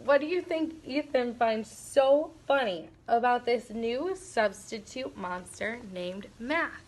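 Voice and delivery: plain reading voice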